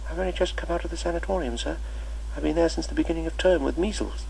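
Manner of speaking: put-on new boy excusing his absence; timid schoolboy. Boy explains, faster.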